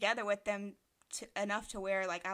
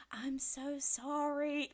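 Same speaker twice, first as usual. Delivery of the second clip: slow, higher mock British apology; imitating a British accent; 7 semitones higher, much slower than usual. Friend retelling the late British guy's apology, mock British accent. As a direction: put on his British accent to say sorry. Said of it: "here he is with his British accent".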